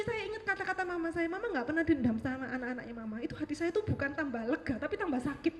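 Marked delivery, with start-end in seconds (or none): none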